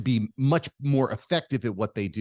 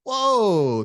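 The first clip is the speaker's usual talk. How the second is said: loud, higher-pitched retold amazement; retold shock; 9 semitones higher, 6 dB louder than usual. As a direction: reenact being blown away, voice jumping up. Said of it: "I was shocked".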